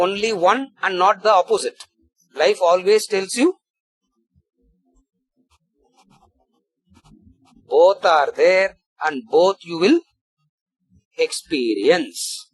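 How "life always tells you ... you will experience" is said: slow emphasis. Real, not acted. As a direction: press every word, slowly. Teacher stresses life's core truth.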